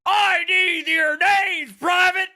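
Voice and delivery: high, loud drill sergeant bark; drill sergeant Karen. Retold or imitated. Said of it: "sounds like a fucking drill sergeant".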